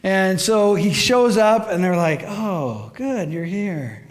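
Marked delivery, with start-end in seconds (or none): 2.2-4.0 slow, underwhelmed greeting; trying to sound pleased and not managing it; mock half-hearted welcome; feigned welcome. Underwhelmed missionaries greet odd recruit.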